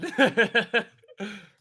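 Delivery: loud high laugh. Real, not acted. Streamer laughing at viewers, loud high laugh.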